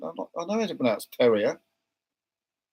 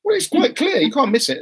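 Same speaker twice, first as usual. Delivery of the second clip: exasperated; 12 semitones higher, 5 dB louder than usual. Real, not acted. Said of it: "exasperated insistence".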